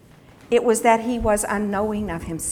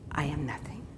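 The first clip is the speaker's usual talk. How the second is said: hushed, broken whisper of surrender; whispered confession; 6 semitones lower, 13 dB quieter than usual. Real, not acted. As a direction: breathe it out, barely audible.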